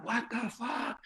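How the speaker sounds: hushed, high mock astonishment; stunned disbelief. Clowns watching Tim get dragged away, hushed disbelief. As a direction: say it high and under your breath, aghast.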